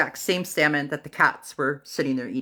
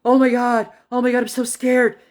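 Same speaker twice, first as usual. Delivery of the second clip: higher, louder panicked voice; imitating scared teen; 5 semitones higher, 5 dB louder than usual. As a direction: act out the boy's fright. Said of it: "He's like".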